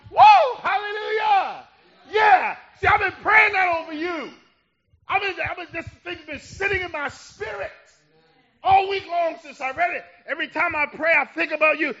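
high, loud, exultant preacher; impassioned praise, pitched high and punched; full-force preaching. Real, not acted. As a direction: cry out the prayer with rising fervor. Preacher erupts into praise and prayer.

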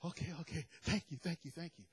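soft, meek, hesitant thank-yous; a hint of a cowed worker; timid thanks. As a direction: shrink back gratefully. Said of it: "He's like".

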